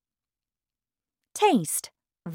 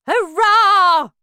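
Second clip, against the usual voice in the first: very loud, high cheer; jubilant shout; 13 semitones higher, 12 dB louder than usual. A narrator's reading. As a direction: let out a big drawn-out hurrah. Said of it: "shouted Grandpa Joe".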